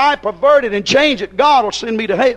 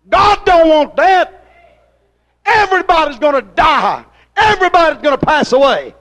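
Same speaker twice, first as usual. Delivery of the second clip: fiery preaching; 6 semitones higher, 5 dB louder than usual. Acted. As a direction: drag it out and lift it up. Preaching at full force.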